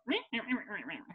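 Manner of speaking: teasing impression of a griping woman; mocking meh. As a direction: parody her nagging as empty noise. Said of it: "she's just like".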